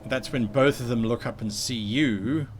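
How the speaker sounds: ordinary talk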